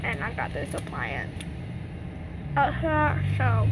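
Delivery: talking with finger in mouth; fingers pulling lip. Real, not acted. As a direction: lip held down while speaking. Seen 1: Finger pulls lower lip down showing braces, also at -1.0 to 0.8.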